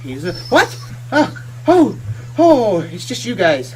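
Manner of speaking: a startled yelp, then relief; startled merchant. Acted. Merchant startled by the party grabbing him. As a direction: caught off guard, then sighs with relief.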